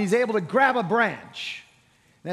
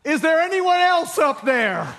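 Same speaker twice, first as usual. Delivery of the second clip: much higher and louder, frantic shout upward; panicked plea; 8 semitones higher, 5 dB louder than usual. Acted. The man refuses God's answer and calls higher and louder. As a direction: yell up in a desperate search for someone else. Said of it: "the man looks up and says".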